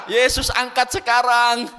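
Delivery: loud, slow imitation with laughter; mock desperate cry. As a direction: echo the daughter's plea, amused and drawn-out. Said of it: "Dia bilang". (seruan pura-pura putus asa sambil tertawa)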